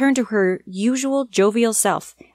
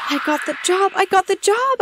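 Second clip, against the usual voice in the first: much higher, rising with joy; inner celebration; 13 semitones higher than usual. A narrator's reading. New hire celebrating in her head, excited squeal. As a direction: squeal it with delight inside. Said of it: "I celebrated in my head".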